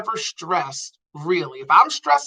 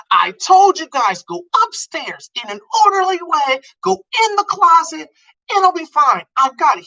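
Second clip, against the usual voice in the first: put-on higher, louder bossy kid shouting orders; commanding yell; 11 semitones higher, 4 dB louder than usual. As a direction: bark orders like a kid taking charge in an alarm. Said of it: "He is yelling and commanding".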